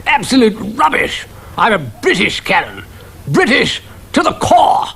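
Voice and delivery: put-on high, blustering old officer; indignant cannon. A narrator's reading. Cannon insisting it is British, blustering. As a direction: huff like an affronted colonel.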